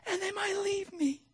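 high, small worried inner voice; inner fearful voice. Retold or imitated. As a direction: voice the fear like a timid child. Voicing his own fearful thoughts.

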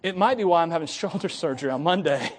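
ordinary delivery

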